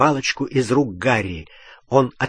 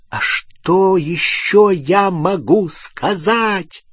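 higher, slow, stretched-out yell; exasperated bellow; 6 semitones higher, 4 dB louder, much slower than usual. A narrator's reading. Ron defending himself to Hermione, bellowing. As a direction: shout back in protest. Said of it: "заорал Рон".

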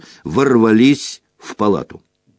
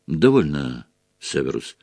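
unruffled; 6 semitones lower than usual. A narrator's reading. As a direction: end it gently and firmly. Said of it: "С обычной невозмутимостью вмешался Дамблдор".

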